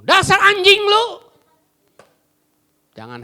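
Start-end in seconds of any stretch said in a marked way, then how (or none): 0.0-1.1 loud, high shouted insult; furious insult. Retold or imitated. Wife yelling an insult back at her husband. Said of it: "Langsung dia ngomong".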